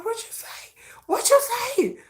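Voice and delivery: high, squeaky mock fan outrage; shrill-voiced fan challenge. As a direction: pounce on it like an offended fan. Fans pouncing on leak.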